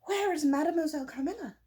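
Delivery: anxious, insistent Madame; impatient Madame. A narrator's reading. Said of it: "impatiently repeating again and again the question".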